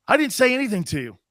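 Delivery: ordinary delivery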